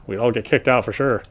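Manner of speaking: usual speaking voice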